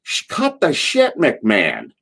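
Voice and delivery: high, stretched mock holler; imitated heckler yell. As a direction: heckle high and slow, dropping on the name. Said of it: "and some ass yelled".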